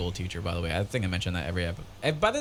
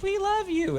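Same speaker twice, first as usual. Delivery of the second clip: put-on very high-pitched kid voice; little children. Dream kids to crying man, tiny children's voices. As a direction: squeak it like adoring children. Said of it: "they're like".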